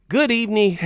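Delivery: high, loud theatrical greeting; hammed-up Joker voice; Joker impression. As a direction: greet like a grinning villain. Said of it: "Heath Ledger's Joker".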